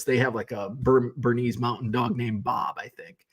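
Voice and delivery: normal talking voice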